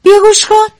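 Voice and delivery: high loud call; shout. Acted. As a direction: cry out urgently to him. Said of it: "فریاد کشید". (فریاد بلند و زیر)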